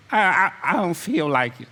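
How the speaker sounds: a hint of a whiny, stammering excuse-maker; mock lazy excuse. Preacher mimics lazy member dodging work, mild mock whine.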